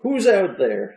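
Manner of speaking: put-on old man voice. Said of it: "Our old man voices".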